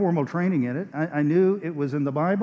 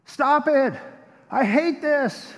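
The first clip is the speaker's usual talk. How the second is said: high anguished cry of a tormented woman; a possessed woman shrieking to stop; 7 semitones higher, much slower than usual. Retold or imitated. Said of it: "this woman starts crying out".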